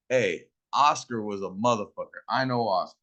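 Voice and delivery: ordinary talk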